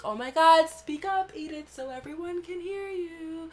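high, loud mock teacher scolding. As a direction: mimic a condescending teacher calling her out. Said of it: "He would be like".

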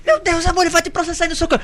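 put-on high alarmed girlfriend voice; mock panicked girlfriend. Imitating girlfriend's fear of a lawsuit. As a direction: squeal it like she's scared. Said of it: "E ela".

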